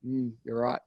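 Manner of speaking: low, soft grudging mm; sheepish concession. Retold or imitated. The client conceding, low and soft. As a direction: give in quietly.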